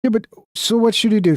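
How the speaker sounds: usual speaking voice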